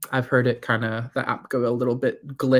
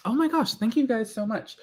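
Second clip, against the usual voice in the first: excited; 11 semitones higher than usual. Real, not acted. Thanking viewers on stream.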